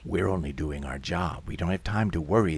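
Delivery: low, soft, impassive worker's voice; indifferent official. Acted. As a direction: dismiss them in a low, unmoved voice. Indifferent movers answer.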